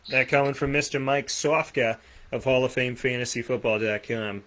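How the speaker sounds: put-on Boston accent. Host crediting the caller Mike Sofka, in a Boston accent. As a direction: slip into a playful Boston accent. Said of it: "said it like that with my Boston accent".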